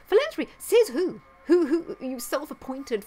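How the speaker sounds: loud, high, indignant challenge; scornful disbelief. Real, not acted. Vlogger rejecting Gates's claim to philanthropy, scornful disbelief. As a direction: throw it back incredulously, pitch leaping up.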